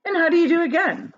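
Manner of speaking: high, lightly voiced old relative; a hint of an old grandparent's voice; high greeting voice. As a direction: chirp the hello up high. Another grandparent greets the reader.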